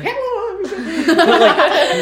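very high squeaky mock voice; mock your own voice, way up high; squeaked greeting breaking into laughter; self-mocking voice. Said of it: "my voice is like".

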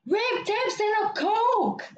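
high, slow mock holler; exasperated coworker. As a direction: spell it out in mock exasperation, stretched. Coworker's fed-up answer, retold.

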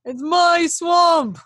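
high, slow, hammed-up yell; mock ogre shout. Retelling Shrek claiming the swamp, mock shout. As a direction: bellow it out as the outraged ogre.

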